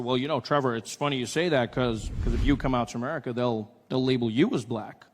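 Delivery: put-on low, soft American accent. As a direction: confide in a relaxed American voice. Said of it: "I met an American".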